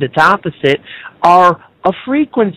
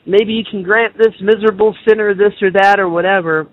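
usual speaking voice

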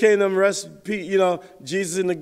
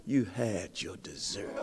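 a much lower, softer, slow motherly put-down; stern mom; 9 semitones lower, 12 dB quieter, slower than usual. Retold or imitated. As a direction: drop low and quiet, and close the matter slowly. Mom refusing dessert.

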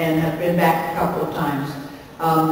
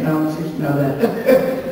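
ordinary talk, nothing marked

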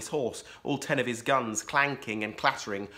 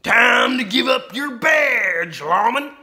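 high, loud, slow shouted snarl; snarling outlaw; 12 semitones higher, 12 dB louder, slower than usual. A narrator's reading. Outlaw Ten Gun Ben snarls at the sheriff, slow and loud. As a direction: bark it at the lawman like a gunslinger. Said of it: "snarled Ten Gun Ben".

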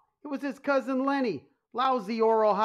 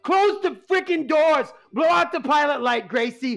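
higher and louder, building to a shout; rising panic; 6 semitones higher, 5 dB louder than usual. Acted. Mob boss panicking over Domino's, building to a shout. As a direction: spiral into hammed-up panic until you're yelling. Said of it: "How long does it take for those stress pills to kick in?".

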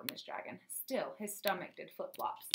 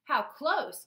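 put-on higher, louder burst from the old knight; exclaiming; 6 semitones higher, 7 dB louder than usual. A narrator's reading. Old knight answering his pupil, exclaiming. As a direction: exclaim it with sudden force. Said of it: "Sir Mort exclaimed".

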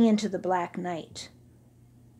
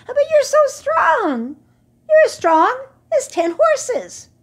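much higher, loud eager praise; young camels cheering; 9 dB louder than usual. Acted. Young camels urging Hashmakaka, high and eager. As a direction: flatter your hero.

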